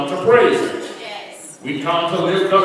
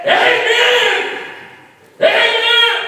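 high, loud panicked shout; frantic cry of amen; yelling at the top of his voice; 5 dB louder, slower than usual. Acted. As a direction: yell it to stop the horse at the cliff. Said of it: "yelled at the top of his voice".

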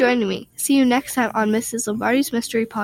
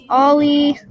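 loud, raised call of a name; 4 semitones higher, 5 dB louder than usual. Acted. Sibling searching for Ollie, calling loudly.

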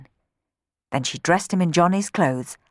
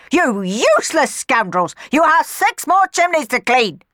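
higher, louder angry rant; furious scolding; 10 semitones higher, 6 dB louder than usual. Acted. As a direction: yell abuse after them. Miss Baggett chasing sweeps, scolding.

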